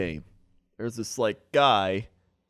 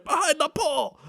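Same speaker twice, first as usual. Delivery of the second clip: mock yelling. Retelling a parking lady yelling at a driver, high put-on shout. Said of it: "she's like".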